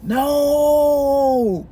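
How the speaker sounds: long, held-out wail of disbelief; dismayed no. Retold or imitated. Storyteller opens the door to Sean, long dismayed no. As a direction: stretch the word out in acted dismay. Protesting to Chris, retold.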